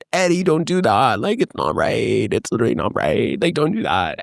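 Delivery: lightly voiced mom pleading with her husband; imitated scolding mom. Voicing mom scolding dad.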